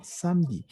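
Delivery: "samedi" in two syllables, sam-di; silent E. Shown as a demonstration. Teacher showing the mute E, two syllables. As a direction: drop the E in "samedi". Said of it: "La E no se pronuncia".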